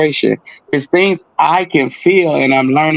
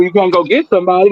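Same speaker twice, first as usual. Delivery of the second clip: usual speaking voice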